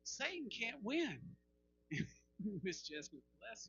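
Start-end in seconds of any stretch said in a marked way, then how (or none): 0.0-1.2 slow, high, each word weighted; insistent declaration. Retold or imitated. Preacher insisting to his wife, slow and emphatic. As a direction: stretch it out, dead certain. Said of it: "I said".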